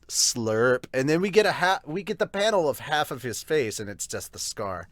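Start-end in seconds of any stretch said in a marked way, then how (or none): none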